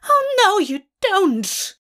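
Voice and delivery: very high, loud screech, falling as it goes. A narrator's reading. Angel swinging the shepherd's stick at the attacker, screeching. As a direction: screech it in outrage. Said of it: "Aziraphale screeches".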